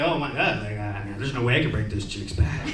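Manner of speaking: low, soft inner voice; hushed, low-pitched mock realization; private musing. Acted private worried thought to himself.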